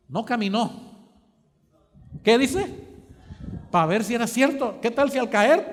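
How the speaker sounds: loud, driving sermon delivery, punching key words; building preacher's call; fervent preaching. Acted. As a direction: preach it out to the congregation. Preaching at full force.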